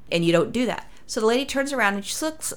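normal talking voice

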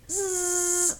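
high, soft sustained zzz; thin high insect whine; imitated mosquito buzz. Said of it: "I've been hearing this".

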